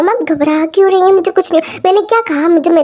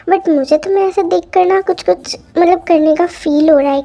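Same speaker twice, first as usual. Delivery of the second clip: plain reading voice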